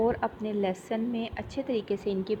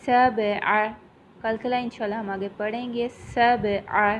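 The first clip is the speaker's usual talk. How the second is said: usual speaking voice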